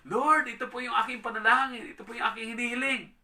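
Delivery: high, loud earnest prayer; praying aloud. Acted. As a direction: lift your request up to the Lord. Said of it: "sabi natin".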